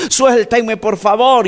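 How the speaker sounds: high, pleading mock shout; imitated yell. Preacher acts out boy held by relatives, high imitated yelling. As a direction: cry out high, as if begging to be released.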